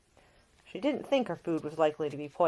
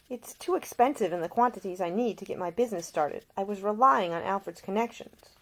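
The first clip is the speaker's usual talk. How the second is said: higher, louder resolute woman; determined Ida; 5 semitones higher, 5 dB louder than usual. Acted. Ida tells Nick she will fund herself, higher resolute voice. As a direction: insist on independence.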